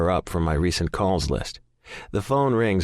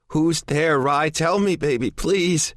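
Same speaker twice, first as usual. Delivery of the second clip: higher, louder frightened plea; pleading; 9 semitones higher, 3 dB louder, slower than usual. A narrator's reading. Desperate man pleading with his girlfriend, frightened plea. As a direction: beg her to answer. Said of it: "I plead".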